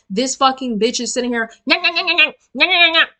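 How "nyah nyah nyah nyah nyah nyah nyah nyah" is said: high-pitched mock nagging gibberish; mocking yapping. Woman mimics her relative talking over her, mock yapping. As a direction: imitate someone yapping on and on, pitched up. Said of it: "it's just a bunch of yapping".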